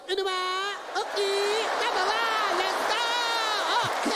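hammed-up childlike Japanese female accent; girlish Japanese woman. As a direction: squeak like a twelve-year-old Japanese girl. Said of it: "they always sound twelve years old".

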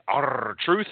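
"R-Truth" with a long rolled R. Shown as a demonstration. Host giving a plain name the same treatment, rolling the R in "R-Truth". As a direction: trill the R at the start of "R-Truth". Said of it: "inflection or rolling R's".

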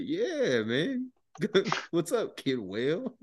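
high, drawn-out indulgent greeting; stretched, swooping words with a smile; smiling sergeant. Retold or imitated. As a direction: imitate an amused superior humouring a drunk. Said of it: "he's just smiling at me".

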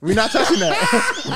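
laughing. Real, not acted. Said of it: "repeating refusal, laughing".

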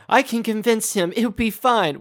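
mock pleading daughter. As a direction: reassure dad in a playful imitation. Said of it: "the dad's like".

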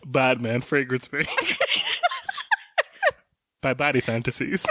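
laughing through a fake deep announcer voice; half-laughing mock deep commercial voice; forcing a deep voice. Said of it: "his voice was, like, fake deep".

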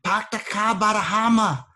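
mock Boston, drop the r in car and Hammer; Hawaii comedian's Boston. Cohost retelling a comedian's Boston bit, mock Boston accent. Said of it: "He was like".

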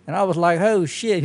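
high-pitched mock Southern voice; exaggerated Southern twang for a joke; Southern accent. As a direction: play it as a dim Southerner. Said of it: "they always use a Southern accent".

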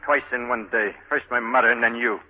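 bitter anger. Acted. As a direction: lash out in disgust. Marty's bitter quarrel with Francie.

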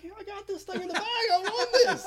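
high-pitched crowing, rising to a loud finish; cocky triumph. Retold or imitated. Contestant sure he has beaten the guy next to him, crowing triumphantly. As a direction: crow like the prize is already yours. Crows over expected win in retold story.

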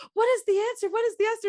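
high-pitched, pestering mock Juliet; hammed-up, high-voiced insistence; impatient Juliet. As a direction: imitate a girl badgering for an answer. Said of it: "Juliet's all like".

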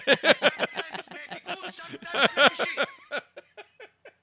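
long burst of high-pitched laughing; high laughter. Real, not acted. Host laughing at the bed banter, high laughter.

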